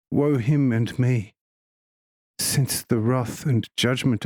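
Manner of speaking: slight lisp on every s. A narrator's reading. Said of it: "in a kind of lisping voice".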